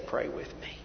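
breathy unvoiced whisper of a plea; hushed pleading whisper. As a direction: whisper it like a private request.

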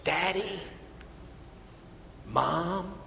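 softly voiced little child, lightly done; gentle imitation of a toddler calling; small child calling. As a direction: call out sweetly like a toddler. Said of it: "a little child ... say".

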